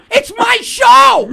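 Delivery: loud, slow indignant cry; shout. Real, not acted. Host shouting at a critical fan, slow indignant yell. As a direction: bellow it, dragging the words. Host defending his podcast.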